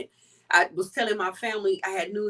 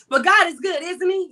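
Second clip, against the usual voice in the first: excited; 8 semitones higher, 6 dB louder than usual. Real, not acted. Churchy call out to audience.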